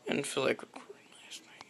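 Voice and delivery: soft unvoiced whisper, a bit fast. Real, not acted. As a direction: breathe it out as a quick secret. Whispered confession to camera.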